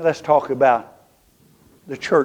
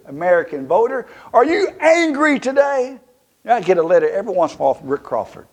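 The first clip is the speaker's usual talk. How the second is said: usual speaking voice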